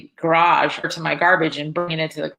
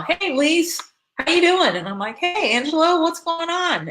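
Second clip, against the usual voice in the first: calling out; 11 semitones higher than usual. Retold or imitated. Neighbors calling out greetings.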